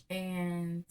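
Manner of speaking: ordinary delivery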